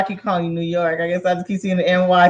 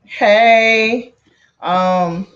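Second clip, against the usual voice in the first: normal talking voice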